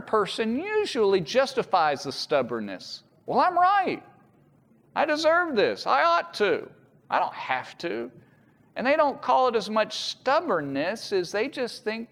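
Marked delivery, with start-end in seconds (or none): 3.2-8.1 stubborn self-justifying. Retold or imitated.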